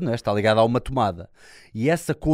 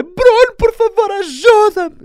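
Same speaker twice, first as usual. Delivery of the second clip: panicked scream, much higher and louder; desperate cry for help; 15 dB louder, slower than usual. Retold or imitated. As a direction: shriek for help as the castle collapses. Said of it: "a minha colega a dizer". (grito desesperado de pânico)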